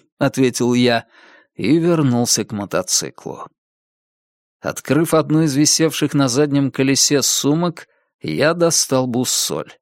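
usual speaking voice

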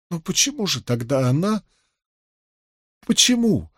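high-pitched plaintive plea; tearful questioning. A narrator's reading. Плачущая Илэйн спрашивает о матери. (жалобно, сквозь слёзы)

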